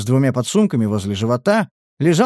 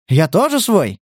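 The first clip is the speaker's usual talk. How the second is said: higher, sarcastic echo; smiling mockery; 6 semitones higher, 3 dB louder than usual. A narrator's reading. Captor ridiculing the prisoner, smiling mockery. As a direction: throw the claim back with a smile. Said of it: "улыбнулся Стас".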